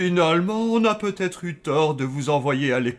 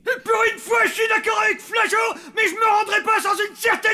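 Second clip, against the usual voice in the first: shouted agreement. Acted. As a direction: bellow the agreement up high. Grumpy character suddenly agreeing loudly.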